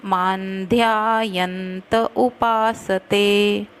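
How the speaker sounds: मान्ध्यायन्त with a full त before उपासते, slow; full त in यन्त. Shown as a demonstration. Teacher showing students the full त in मान्ध्यायन्त. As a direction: say मान्ध्यायन्त as maandhyaayanta. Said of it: "यंत पूरा बोलना त".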